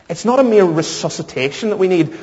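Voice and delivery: ordinary talk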